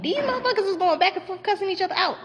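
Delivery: high, fast incredulous outburst; exasperated disbelief. Real, not acted. Storyteller at the climax of the fight, exasperated disbelief. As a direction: pitch shoots way up and stays there, racing.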